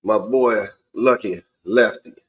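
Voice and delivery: ordinary delivery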